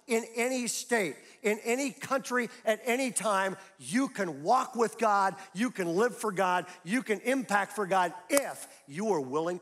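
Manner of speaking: loud, high, passionate sermon delivery; fiery pulpit voice; full-force preaching. Acted. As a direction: drive the point home to the congregation.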